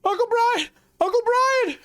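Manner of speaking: high, loud scream for help; panicked screaming. Acted. Penny screams for Uncle Brian, high loud scream. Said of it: "you immediately hear Penny screaming".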